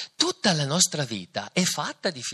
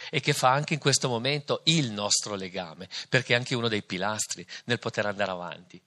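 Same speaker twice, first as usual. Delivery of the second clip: ordinary talk, nothing marked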